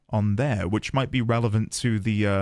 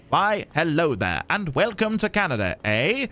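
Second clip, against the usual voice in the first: mock Canadian accent; 2 semitones higher than usual. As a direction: put on a hammed-up Canadian accent. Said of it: "meant to be in Canada".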